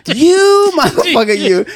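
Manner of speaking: high, loud teasing accusation with a pointed finger; mock accusing coworker. Maintenance guy teasing the teller, high and loud accusing.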